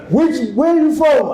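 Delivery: high mocking voice of condescending forum delegates; mock them, pitched high, breezing fast through "Professor, very interesting"; imitating dismissive delegates. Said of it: "delegates pressing him again".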